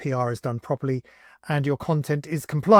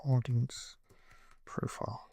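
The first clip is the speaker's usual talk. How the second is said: soft murmur trailing into a whisper; quiet murmur; 3 semitones lower, 10 dB quieter, slower than usual. Real, not acted. Typing the audience field, murmuring to himself into a whisper. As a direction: mouth it to yourself as you type.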